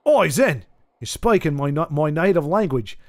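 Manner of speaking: put-on playful phonetic Australian accent. Streamer reading an Australian viewer's message, in an Australian accent. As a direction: read it out in Australian. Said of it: "you do the accent".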